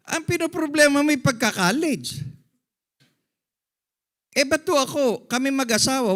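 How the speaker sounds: loud, high preacher's exhortation; loud, high fired-up pastor pleading with parents; full-force preaching. Acted. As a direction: rouse the congregation, voice up and loud.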